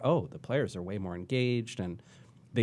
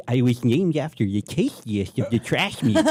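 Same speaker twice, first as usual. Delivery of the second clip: goblin voice; 2 semitones higher, 7 dB louder than usual. Retold or imitated. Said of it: "giblet character that she's like".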